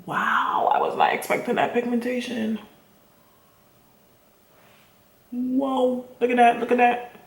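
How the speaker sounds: quietly amazed, real surprise; surprised. Real, not acted. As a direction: caught off guard by the colour. Surprised by strong pigment.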